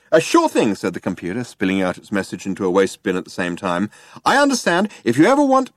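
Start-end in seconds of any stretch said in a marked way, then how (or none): 0.1-0.7 high, eager chirp; brash and cheery. A narrator's reading. Computer obliges Zaphod, brash and cheery. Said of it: "brash and cheery". 4.2-5.7 very high, gushing eagerness; brash and cheery. A narrator's reading. Computer keeps offering Zaphod help, brash and cheery. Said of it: "brash and cheery".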